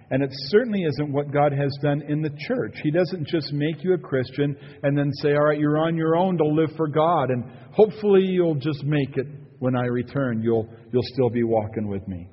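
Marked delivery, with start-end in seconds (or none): none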